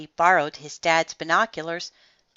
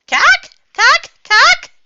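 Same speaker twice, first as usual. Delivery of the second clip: very high, loud bird cackle; myna bird laughter; 14 dB louder, slower than usual. A narrator's reading. Myna birds laughing at Froggy's hula, squawking laughter. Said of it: "even the myna birds laughed".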